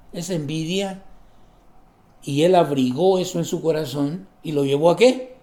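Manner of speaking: usual speaking voice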